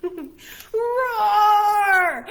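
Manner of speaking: very loud high roar, the biggest yet; huge dinosaur roar. Acted. Huge dinosaur smashes into the room, loud roar. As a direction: roar as loud as you can.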